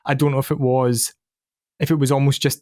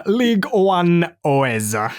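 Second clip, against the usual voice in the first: very slow, stretched-out League One Oeza, a little higher; drawn-out quote; 4 semitones higher, much slower than usual. Retold or imitated. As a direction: savour each word of the famous line. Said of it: "that interview is really, really funny".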